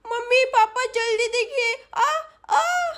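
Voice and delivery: put-on high childish alarm; little girl calling for help. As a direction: call out for parents in a small high voice. Little girl calls parents for help.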